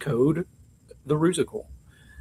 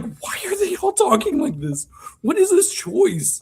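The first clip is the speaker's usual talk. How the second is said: much higher, disbelieving what-is-this cry; baffled outburst. Retold or imitated. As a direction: squeak it out in total disbelief. Host retells her own baffled reaction.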